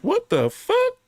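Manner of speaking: very high, strangled disbelief; squeaky stunned outburst; shocked squeak. Retold or imitated. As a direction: shoot the pitch way up in shock. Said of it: "I'm like".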